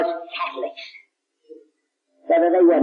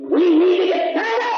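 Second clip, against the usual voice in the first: rousing shout; 10 semitones higher than usual. Real, not acted. Preacher rousing the church to get stirred up, shouting. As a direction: lift the church with a cry.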